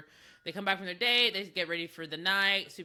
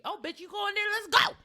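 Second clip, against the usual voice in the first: matching her energy; 12 semitones higher than usual. Retold or imitated. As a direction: egg her on, high and rising to a yell. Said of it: "Trinity can match her energy".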